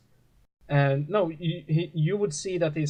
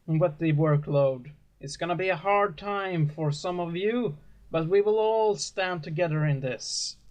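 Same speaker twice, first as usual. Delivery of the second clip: raised, lofty sermon voice of a gentle preacher; 5 semitones higher than usual. Acted. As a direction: preach to the flock like a kindly father. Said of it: "And he says".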